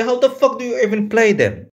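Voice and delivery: ordinary talk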